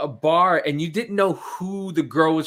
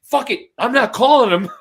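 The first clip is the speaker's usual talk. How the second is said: loud, higher burst of giving up; mock exasperation; 7 semitones higher, 8 dB louder than usual. Dialer who screwed up the rotary number, mock exasperation. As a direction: throw in the towel, comically fed up.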